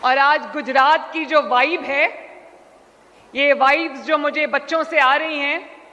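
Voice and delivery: measured oratory with long pauses between phrases; formal public speech. Real, not acted. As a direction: address the hall phrase by phrase, letting each one land. Formal address to assembled audience. (ٹھہر ٹھہر کر رسمی عوامی خطاب)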